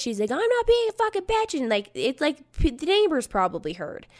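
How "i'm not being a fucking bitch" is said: much higher whiny retort; hammed-up mocking voice; mocking friend. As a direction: mimic her shrill denial. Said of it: "She's screaming at me".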